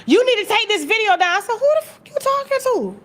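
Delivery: usual speaking voice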